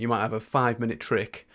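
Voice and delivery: ordinary delivery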